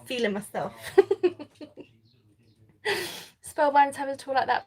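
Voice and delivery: usual speaking voice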